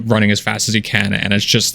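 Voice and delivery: normal talking voice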